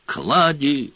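firm call into the dark. A narrator's reading. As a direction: call a name down the corridor. Searching the castle, calling a name.

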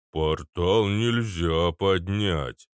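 slow, heavy, literal answer; ponderous giant. Acted. Arnold corrects the girl, ponderous and slow. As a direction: spell it out very slowly.

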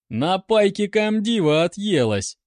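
high, loud jeer; sarcastic. A narrator's reading. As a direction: taunt with acted sarcasm. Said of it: "съязвил другой".

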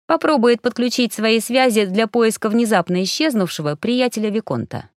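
usual speaking voice

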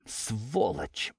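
hushed, venomous hiss; angry hiss. A narrator's reading. Jilted girl cursing the man to herself, hissed. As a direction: hiss the curse under your breath. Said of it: "прошипела девушка".

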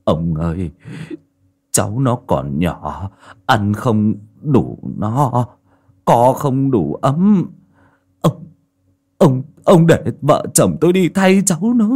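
put-on an old man begging for his grandson; slow pleading. A narrator's reading. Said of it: "mà van nài".

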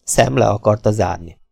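plain reading voice

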